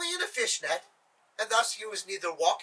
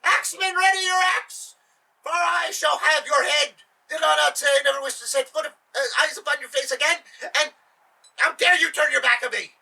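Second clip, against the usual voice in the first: furious order; 6 semitones higher, 6 dB louder than usual. Acted. Sultan ordering the beheading.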